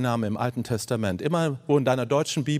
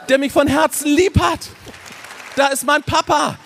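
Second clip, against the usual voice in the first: building, louder and higher toward joyous cry; rising joy; 9 semitones higher, 4 dB louder than usual. Real, not acted. Preacher to congregation, rising into joyous exclamation. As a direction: swell with delight over the loving father.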